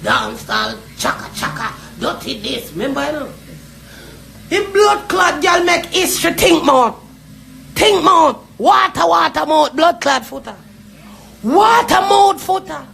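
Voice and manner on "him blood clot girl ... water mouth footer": loud, furious insults hurled out; real angry rant; angry shouting. Real, not acted. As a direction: shout her down with scorn.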